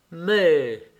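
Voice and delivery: mildly surprised cry; exclamation of recognition. A narrator's reading. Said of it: "s'écria-t-il".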